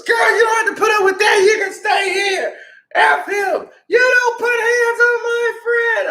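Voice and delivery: very high mock-female shouting; imitated indignant girlfriend. Her friend defending her after the fight, high indignant imitation. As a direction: scold in a squealed, outraged falsetto. Other girls' indignant protest, acted.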